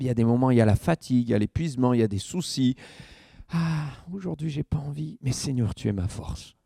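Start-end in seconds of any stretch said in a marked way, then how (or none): 3.5-6.6 soft, subdued imitation of a tired believer; weary prayer. Tired believer praying to the Lord, soft and weary.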